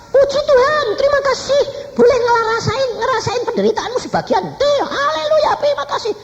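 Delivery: very high, loud hallelujah cries; hammed-up high-pitched praising; retold frantic praise. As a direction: imitate someone shrieking thanks to God. Retold frantic praise after God's rebuke.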